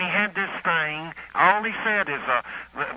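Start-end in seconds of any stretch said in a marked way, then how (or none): none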